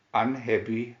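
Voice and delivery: ordinary delivery, nothing marked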